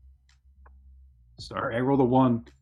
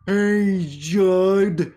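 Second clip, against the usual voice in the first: high, loud calling troll; 7 semitones higher, 6 dB louder, much slower than usual. Acted. Troll calls to his buddy, high loud call.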